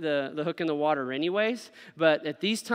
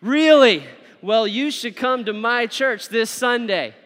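higher, louder, slower chant together; loud group recitation; 4 semitones higher, 8 dB louder, slower than usual. Shown as a demonstration. Preacher leading the congregation to recite the invite loudly. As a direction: lead the room through the line. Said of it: "let's say this together".

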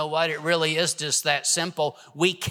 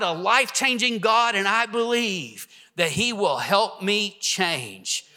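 raised, urgent preacher's voice; preaching; 4 semitones higher than usual. Acted. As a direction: preach it with fire to the church. Pastor preaching to congregation.

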